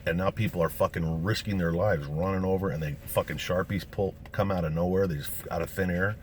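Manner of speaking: normal talking voice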